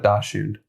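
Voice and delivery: Dachshund said as "DAH-shoond"; questioned pronunciation. Real, not acted. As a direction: say Dachshund with a sh in the middle. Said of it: "am I pronouncing it wrong".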